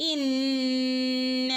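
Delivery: ഇന്ന with a long humming nasal; ghunna. Shown as a demonstration. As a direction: hold the ന്ന hum long. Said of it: "നന്നായിട്ട് മണിക്കുക". (ഇന്ന ഗുന്നയോടെ)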